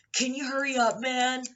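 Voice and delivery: high, loud impatient demand; mock tough guy. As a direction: mimic a pushy man yelling to hurry. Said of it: "seem like a tough guy".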